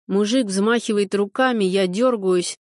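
plain reading voice

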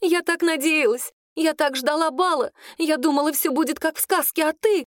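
put-on high, plaintive girlish voice; tearful lament. A narrator's reading. Claudine mourns her dream of the ball, high and plaintive. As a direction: lament it, pitched well up. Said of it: "Она зарыдала в голос".

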